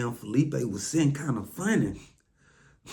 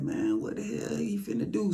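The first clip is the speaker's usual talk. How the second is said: alarmed; 10 semitones higher, 6 dB quieter than usual. Retold or imitated. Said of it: "So I'm like".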